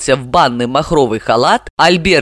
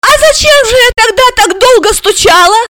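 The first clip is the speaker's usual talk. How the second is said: put-on very high, loud child's question; little girl in disbelief; astonished; 5 dB louder than usual. A narrator's reading. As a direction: squeal in puzzled amazement. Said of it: "поразилась Алиса".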